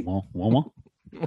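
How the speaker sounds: bon said with a hammed-up French accent, nasal on; mock French. Host showing off his French accent to his co-host. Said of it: "I really leaned into it".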